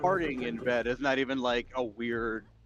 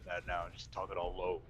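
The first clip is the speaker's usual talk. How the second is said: deep voice; 5 semitones lower, 10 dB quieter than usual. Acted. Teasing very low voice.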